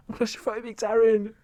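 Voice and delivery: Essex accent impression; Gemma Collins. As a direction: quote a reality star in an Essex accent. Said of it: "I love Gemma Collins".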